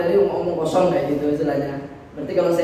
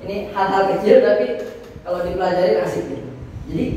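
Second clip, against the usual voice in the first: ordinary delivery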